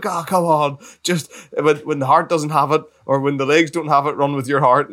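rally the runner with a loud excited cheer. Retold or imitated. Runner retelling the crowd urging him on, loud and excited.

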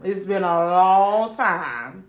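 "long time" stretched very slowly; drawn-out emphasis. Real, not acted. Friend defending her posting record, slowly stretching "long time".

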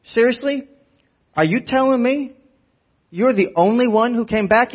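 high-pitched incredulous tough guy; De Niro. Acted. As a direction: imitate a movie tough guy demanding an answer. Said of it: "Do you hear it in De Niro".

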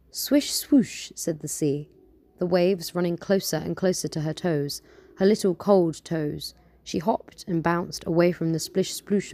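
lively storytelling read-aloud; story narration. Acted. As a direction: tell it like a bedtime tale. Reads her own story aloud.

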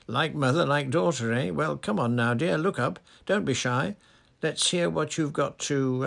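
high, loud jolly coaxing; a booming avuncular coax; jovial Fudge. A narrator's reading.

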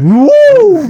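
high, loud cheer; excited whoop. Real, not acted. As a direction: whoop it up for a friend. Cheering at friend's calves.

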